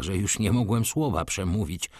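plain reading voice

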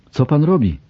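sudden high cry of alarm; alarmed outcry. A narrator's reading. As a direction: blurt it out in shock. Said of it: "nie mógł powstrzymać okrzyku".

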